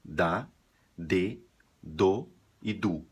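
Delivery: slow, clear Spanish da, de, do, du; syllables da de do du. Shown as a demonstration. Teacher drilling Spanish syllables for students, slow and clear. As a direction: spell out each syllable slowly. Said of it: "em espanhol soa igual".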